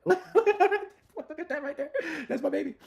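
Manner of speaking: high, laughing burst of pride; delighted laughing. Real, not acted. Creator laughing as his agent team opens, delighted. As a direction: squeal with laughter at your creation.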